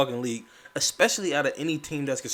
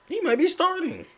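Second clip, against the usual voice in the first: disbelief; 6 dB louder than usual. Real, not acted. Shocked at the Spurs lineup.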